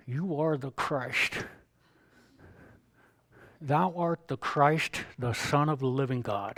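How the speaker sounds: slow, drawn-out dramatic Bible reading; dramatic professor. Retold or imitated. Professor reading Peter's confession to his class, slow and dramatic. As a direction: intone it grandly like a theatrical teacher. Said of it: "Doctor Eckman had an interesting way of speaking".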